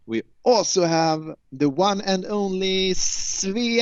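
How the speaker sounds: big slow build to the name; announcer introduction. Real, not acted. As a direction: stretch it out like a show host presenting the star. Host introducing his co-host.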